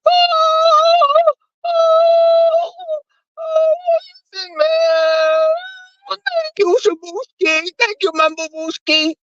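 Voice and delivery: very high, drawn-out falsetto wail; squeaky overjoyed thanks to a donor; imitating Ness. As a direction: swoon over the cash like a tearful diva. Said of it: "she said".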